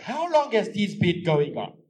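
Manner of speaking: loud, demanding; accusing. Acted. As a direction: confront him. Wife angry at husband's lie.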